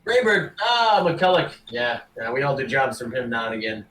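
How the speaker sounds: recognise the name, then haggle offhandedly; orc merchant. Acted. Orc dealer making the adventurer an offer, lively then casual.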